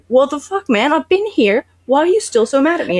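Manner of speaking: high-pitched mock-indignant complaint; exasperated protest. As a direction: imitate a man protesting unfair treatment. Retold quarrel, Cahir protests to Geralt.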